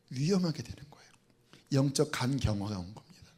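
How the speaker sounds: grave whisper. Real, not acted. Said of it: "grave warning in sermon".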